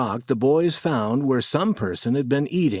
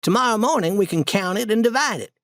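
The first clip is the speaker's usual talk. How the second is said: put-on higher boyish voice, brisk; lightly voiced boy laying out plans; planning Tom; 8 semitones higher than usual. A narrator's reading. Tom plans hiding the money for the night, higher boy voice.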